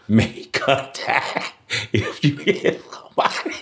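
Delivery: laughing too hard to talk; breaking into laughter. Real, not acted. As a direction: squeeze the words out through laughter. Cracking up at own story.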